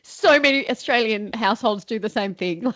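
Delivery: ordinary delivery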